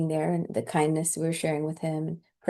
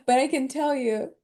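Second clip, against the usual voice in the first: normal talking voice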